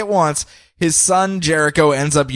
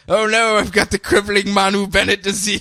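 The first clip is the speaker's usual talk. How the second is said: mock dramatic lament; 7 semitones higher than usual. Mocking young Deathstroke.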